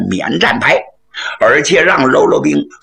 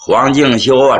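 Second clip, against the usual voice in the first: ordinary delivery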